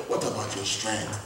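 low and soft, almost to himself; a murmured question to the congregation; quiet question. Shown as a demonstration. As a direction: drop the voice and ask quietly.

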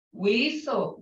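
ordinary delivery

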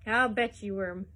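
mock him for his excuse. A narrator's reading. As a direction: Hines jeering at Joe's father. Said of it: "Hines mocked".

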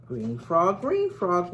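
ordinary delivery

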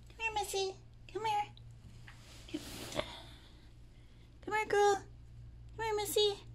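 high-pitched soft coaxing, partly whispered. Real, not acted. Owner softly coaxing her shy cat, high and gentle. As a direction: coax the cat in a tiny high voice.

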